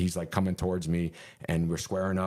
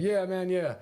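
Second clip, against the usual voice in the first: high-pitched mock casual answer; imitated inmates' reply; 11 semitones higher, slower than usual. Inmates covering up the fight for the officer, imitated high reply. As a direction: call back high, as if all is normal.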